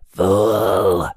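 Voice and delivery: a lightly voiced rasp for the ghoulish customer; rasping skeletal customer. A narrator's reading. Skeletal customer scorning Juan, lightly rasped. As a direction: spit the word out like a sandy-throated ghoul. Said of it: "His voice rasped as if he'd swallowed a pound of sand".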